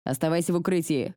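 ordinary delivery

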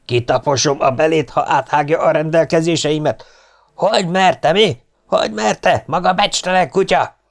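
high, furious tirade; raging officer. A narrator's reading. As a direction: dress down a subordinate in a rage. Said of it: "Az őrnagy valósággal tombol dühében".

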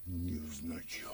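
breathy, teasing echo; mocking whisper. Master echoing the maid's excuse in a sly whisper. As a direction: whisper the word back slyly.

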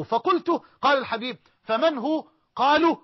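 high, loud, rising question; rising suspense. Acted. Preacher to congregation asks who owns the palace, high and loud suspense. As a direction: build the suspense to the top.

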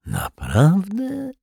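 slow question soaring very high; feigned amazement. A narrator's reading. Rogue feigns surprise at the detective, mock-amazed. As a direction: swoop up in mock wonder. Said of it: "zrobił zdumioną minę".

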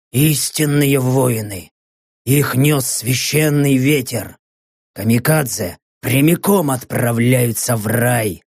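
low, slow machine voice; metallic Kappa. Acted. Kappa on the kamikaze warriors, low metallic voice.

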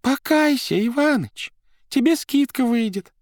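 high, loud pleading wife; wife crying out. Acted. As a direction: cry out to the husband, urging. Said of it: "вскричала".

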